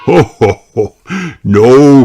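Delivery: hearty chuckle, then a loud no; jolly dad's ho ho ho; Daddy Pig laughing. Acted. Narrator voices Daddy Pig.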